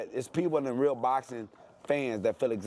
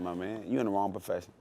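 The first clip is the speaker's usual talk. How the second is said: usual speaking voice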